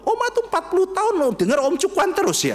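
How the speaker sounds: put-on high, quick, amazed kid's question; incredulous child. Child amazed grandma heard one pastor forty years. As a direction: gasp it out in disbelief.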